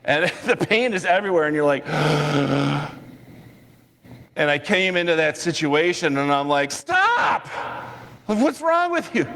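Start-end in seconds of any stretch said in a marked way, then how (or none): 1.9-2.9 long acted gasp of dismay; imitated speechless, panicky gasp; mock overwhelmed gasp. Imitated teacher's overwhelmed reaction. 6.7-9.3 very high-pitched acted teacher's yell; mock exasperated shout at unruly kids. Teacher yelling at chaotic class.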